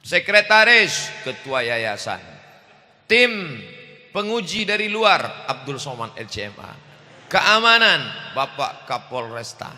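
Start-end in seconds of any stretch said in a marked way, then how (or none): 0.1-1.1 high, loud announcer call; MC roll call. Pretend. MC calling out the guests. 3.1-5.5 high, loud announcer call; MC roll call. Pretend. MC calling out the guests. 7.2-8.1 high, loud announcer call; MC roll call. Pretend. MC calling out the guests.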